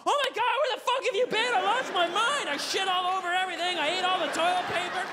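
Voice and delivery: a needy dog losing it; dog greeting owner in a frenzy; frantic dog. Acted. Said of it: "they're like".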